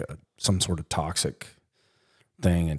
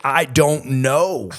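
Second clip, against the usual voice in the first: retold helpless answer; 7 semitones higher, 5 dB louder, much slower than usual. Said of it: "I was like".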